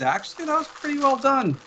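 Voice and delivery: high mock voice, well above his own; Noah's reply. GM quoting Noah, a high mock voice. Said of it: "Noah says".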